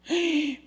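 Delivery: high, short mock gasp; jadeo escandalizado. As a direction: gasp as a shocked listener would. Voicing a shocked church member. (jadeo agudo de sorpresa fingida)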